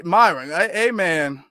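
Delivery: ordinary talk, nothing marked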